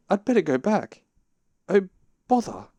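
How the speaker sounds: high, loud fretting; dismayed. A narrator's reading. Pooh wedged in the hole, dismayed.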